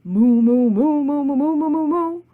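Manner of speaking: rhythmic moos climbing in pitch; sung chorus of moos; cows mooing. Acted. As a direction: moo along to the tune. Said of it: "and they started".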